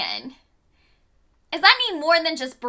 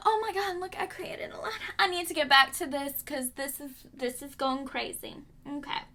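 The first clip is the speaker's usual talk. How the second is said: muttered aside; 6 dB quieter than usual. Real, not acted. Vlogger muttering to herself about rambling on, much softer. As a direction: drop to a soft self-aside.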